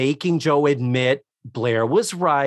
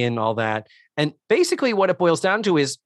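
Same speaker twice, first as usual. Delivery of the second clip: ordinary talk, nothing marked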